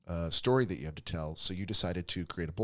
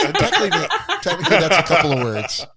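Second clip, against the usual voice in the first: loud, higher, through laughter; laughing; 8 semitones higher, 12 dB louder than usual. Real, not acted. Host teasing the learner over the word count, laughing. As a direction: burst out teasing while laughing.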